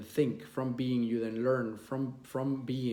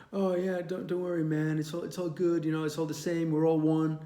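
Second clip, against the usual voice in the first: hippie voice; 4 semitones higher than usual. Retold or imitated. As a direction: play the old hippie. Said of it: "it's like the old hippie thing".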